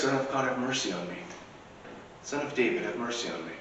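soft, hushed prayer for mercy; a murmured plea, almost to oneself; quiet plea. Acted. Worshippers praying quietly to Christ, soft plea. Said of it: "we call out to Christ".

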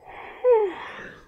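loud, high sigh; exasperated sigh. Real, not acted. Fed up with boys, exasperated sigh. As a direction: let out a big sigh over it.

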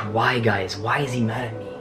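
ordinary talk, nothing marked

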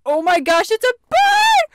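very high, loud exclamation rising to a shriek; excited shout. Acted. Someone spotting a bird, high excited shout. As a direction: shout it, pitch shooting up on bird.

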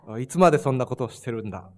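quick, low mock scolding in Japanese; angry Japanese parent. Guest repeats the parental scolding on request, mildly angry. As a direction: reenact a parent's telling-off, low, loud and fast. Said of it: "Gimana tadi marahnya?".

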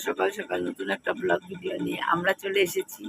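hoarse from a cold; husky voice from a sore throat. Real, not acted. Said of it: "ঠান্ডা লেগে আমার গলা গেছে বসে".

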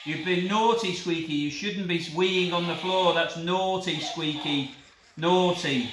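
loud, slow scolding of a toy dog; hammed-up stern telling-off; mock telling-off. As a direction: shout at him like a naughty puppy. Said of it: "if you shout at Squeaky and tell him off".

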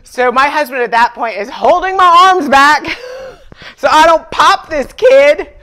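loud, laughing outburst. Real, not acted. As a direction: laugh through the story. Laughing retelling of anger.

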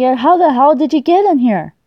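ordinary talk

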